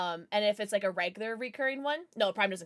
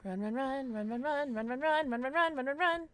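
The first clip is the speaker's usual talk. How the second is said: urgent panicked chant; rising panic while fleeing; being chased; 3 semitones higher than usual. Real, not acted. Fleeing enemy in game.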